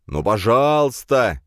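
high, loud, drawn-out please; whining plea. A narrator's reading. Friend blocking the exit, wailing a drawn-out plea. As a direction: wail it like a pestering pal. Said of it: "прервал я его бесконечные завывания".

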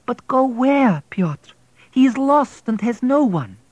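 put-on a wife pleading for the boy. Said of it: "Vladik's protectress pleaded".

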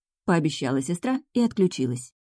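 ordinary delivery

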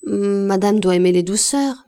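ordinary delivery, nothing marked

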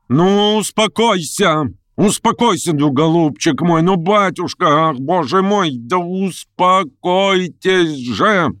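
очень высокий, отчаянный, протяжный крик; кричи высоко, умоляя успокоиться; неистовый крик. A narrator's reading. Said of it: "крикнула она неистово". (неистово, на высоком крике)

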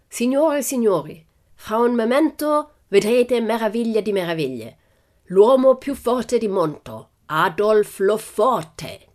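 put-on high, loud ringmaster with foreign accent; proud showman booming to the crowd; circus director. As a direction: announce the star act, drawing out the name. Circus director announces the strongman.

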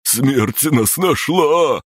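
high, slow terrified squeal. A narrator's reading. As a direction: squeal in terror. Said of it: "взвизгнул каштановый".

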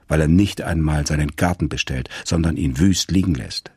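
usual speaking voice

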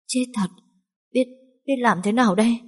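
rising to a high frantic cry; panicked. Acted. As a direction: terror of prison takes over. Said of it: "Tâm trạng vừa hoảng loạn vừa hoang mang".

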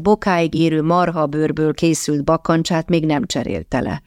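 ordinary delivery, nothing marked

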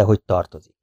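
plain reading voice

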